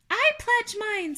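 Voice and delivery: high tiny spider voice; small high-pitched character voice; first little spider. Acted. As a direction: pipe up as a little creature.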